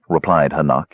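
ordinary delivery

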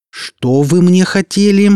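cold fury; girl demanding what they meant to feed her. A narrator's reading. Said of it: "повысив голос, холодно проговорила Оля".

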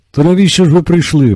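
high, loud exasperated shout. A narrator's reading. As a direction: keep yelling so she hears. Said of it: "продовжував кричати лікар".

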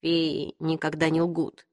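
put-on low, slow, guarded young man. A narrator's reading. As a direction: speak low and measured. Jace voiced in the story.

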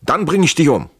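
high, loud acted threat; exclaimed retort. A narrator's reading. Trenck answers his injured friend's question, exclaimed retort. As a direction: throw the answer back forcefully.

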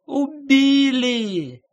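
very high, long-drawn wail; loud, stretched shriek of a woman; wailing scream. A narrator's reading. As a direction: cry the alarm out of a window. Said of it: "заголосила, высунувшись в окно".

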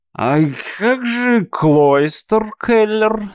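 put-on drunk, slow and slurring; tongue tripping over the word; slurred drunk speech. A narrator's reading. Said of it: "заплетающимся языком подсказал".

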